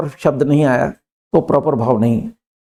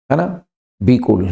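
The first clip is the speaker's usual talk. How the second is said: ordinary delivery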